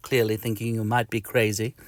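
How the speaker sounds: ordinary delivery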